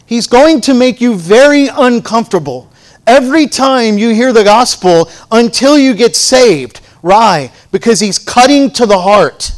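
high, loud sermon delivery; impassioned preaching. Real, not acted. Preacher warns congregation about resisting God's change, impassioned preaching. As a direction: press it home at full force.

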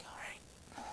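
breathless hushed whisper; nervous whisper. Retold or imitated. Peter steeling himself to step out, whispered. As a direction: whisper it, bracing yourself.

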